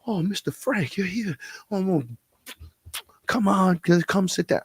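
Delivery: high, gushing welcome in a put-on voice; excited waiter greeting a regular couple; fawning waiter. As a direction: fuss over the guest as you seat him. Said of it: "and he goes".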